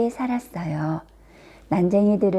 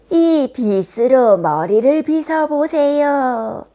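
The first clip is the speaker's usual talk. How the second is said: slow, loud, high peddler voice; disguised queen's theatrical sales pitch; old peddler woman; 5 semitones higher, 6 dB louder, slower than usual. A narrator's reading. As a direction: coax her with drawn-out words. Disguised queen sells a comb.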